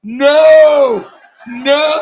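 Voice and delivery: long, loud, drawn-out "no"; horrified wife's shout. Retold or imitated. Wife at the back begging him to stop, imitated horrified shout.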